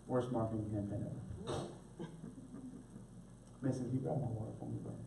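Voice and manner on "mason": normal talking voice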